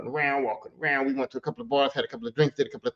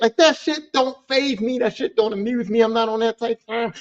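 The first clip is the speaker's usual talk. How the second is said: ordinary talk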